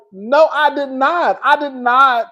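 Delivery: mock flustered denial, high; high insistent denials as Riley; imitating Riley protesting she didn't do it; Riley denying it. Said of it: "Riley was like".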